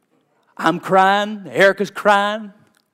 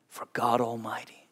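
usual speaking voice

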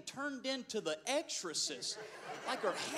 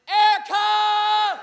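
slow, high, loud panicked call; 10 semitones higher, 17 dB louder, much slower than usual. Retold or imitated. Preacher retelling calling his wife from the shower, loud. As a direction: yell for help across the house.

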